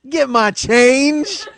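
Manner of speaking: high, slow, loud command; hammed-up drawn-out demand; shouted order. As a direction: draw out each word as a big movie catchphrase. Acts movie line ordered to Jerome.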